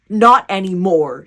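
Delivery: loud, slow and firm; firm defiance. A narrator's reading. Jessie stands up for her brother, firmly defiant. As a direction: put your foot down, word by word.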